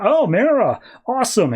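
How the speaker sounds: high, mock-delighted exclamation; Aquaman. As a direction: imitate a thrilled greeting. Aquaman delighted to see his queen.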